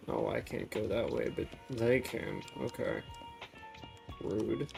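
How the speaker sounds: usual speaking voice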